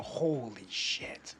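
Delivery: very slow, low, hushed amazement; dazed, drawn-out murmur of awe; stunned awe. Retold or imitated. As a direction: draw it way out, low and soft, dumbstruck. Acts out coming back stunned.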